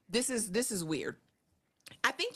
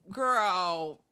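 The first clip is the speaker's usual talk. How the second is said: ordinary talk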